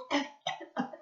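a light smoky cough. A narrator's reading. George in the smoky station, a light cough. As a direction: cough as if the room fills with smoke. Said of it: "coughed George".

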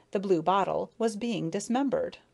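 usual speaking voice

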